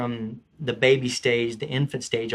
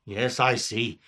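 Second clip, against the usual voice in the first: King Arthur; 5 semitones lower, 5 dB quieter, slower than usual. Acted. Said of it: "King Arthur".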